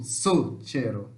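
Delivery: slow, low "Succeiro", leaning on SU; stress on SU. Shown as a demonstration. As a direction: demonstrate "Succeiro" syllable by syllable, first one strong. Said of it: "Mais forte é o su". ("Succeiro" devagar, com o su mais forte)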